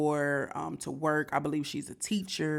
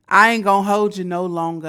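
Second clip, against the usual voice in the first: loud hype; 3 semitones higher, 9 dB louder than usual. Real, not acted. Energetic outro call to viewers.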